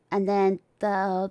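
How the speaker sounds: normal talking voice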